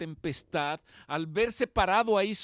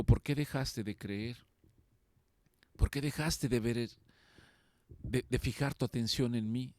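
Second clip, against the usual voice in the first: much lower and softer, tender questioning; merciful gentle rebuke; 6 semitones lower, 3 dB quieter than usual. Acted. Jesus gently asking Peter why he stopped believing, soft and tender. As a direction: ask it gently, full of mercy. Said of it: "con esa misericordia".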